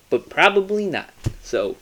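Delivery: usual speaking voice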